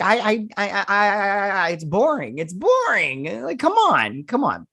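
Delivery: high, stammering mock exasperation; stammer, then drag out the complaint high; exasperated whining. Reviewer griping to viewers about a dull episode, exasperated whining. Mocking own bored reaction to show.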